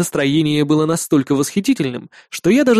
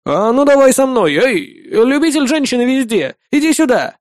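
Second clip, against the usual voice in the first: high, louder party holler; calling out; 7 semitones higher, 3 dB louder than usual. Acted. Partygoer summoning the ladies' man to join in, calling out. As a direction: call him over boisterously.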